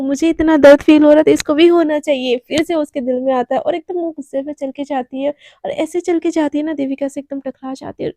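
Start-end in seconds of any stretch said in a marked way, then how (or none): 0.0-2.4 angry. Acted. Radhika's angry inner thought.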